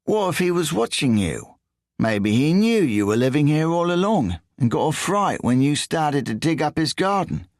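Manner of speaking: high, whiny English voice; whiny Englishman. A narrator's reading. English thief quizzes Riso in a high whiny voice. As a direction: push it up into a whine.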